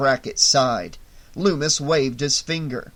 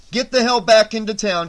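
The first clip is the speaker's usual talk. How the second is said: loud, higher command; order; 6 semitones higher, 7 dB louder than usual. A narrator's reading. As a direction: order him back, no room for argument. Sheriff orders deputy.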